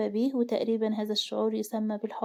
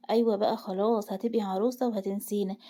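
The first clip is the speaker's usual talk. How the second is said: plain reading voice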